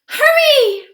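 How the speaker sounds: put-on high, loud little girl's cry; young gorilla Ruby cries out to hurry the elephants; urgent cry. A narrator's reading. Urgent cry. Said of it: "Ruby cries".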